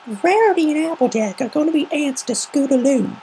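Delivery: little filly catching on with delight; Apple Bloom realizing. Acted. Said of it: "with dawning realization".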